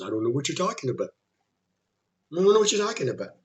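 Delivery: imitating someone calm and unimpressed; unconcerned, dismissive. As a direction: brush it off casually, as if nothing is wrong. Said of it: "calm and relaxed and unconcerned".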